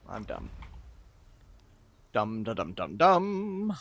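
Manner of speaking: normal talking voice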